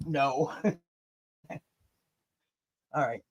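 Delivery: normal talking voice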